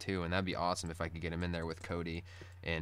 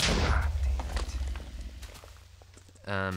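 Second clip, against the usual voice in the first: loud breathy frustrated curse; frustrated outburst; 5 dB louder, slower than usual. Real, not acted. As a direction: blurt it out in annoyance. Frustrated exclamation during game.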